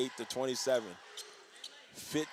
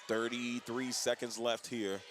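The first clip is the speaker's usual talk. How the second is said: ordinary talk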